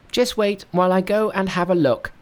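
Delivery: high, bright-pitched monkey king. Acted. Narrator voicing Monkey to his master, high monkey voice. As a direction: play the cocky monkey hero up high.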